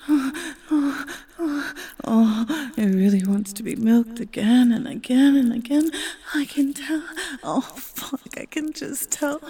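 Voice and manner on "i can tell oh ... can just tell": unvoiced, hushed whisper. Lover admiring her partner, soft whisper. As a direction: breathe it softly as a secret.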